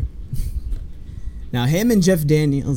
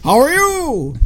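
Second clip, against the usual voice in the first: high, drawn-out holler; calling out; 12 semitones higher, 4 dB louder, slower than usual. Retold or imitated. Fan acts out the Grinch questioning his echo, hollering.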